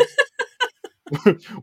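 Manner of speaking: high burst of laughter; a sudden high laugh. Real, not acted. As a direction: crack up mid-question.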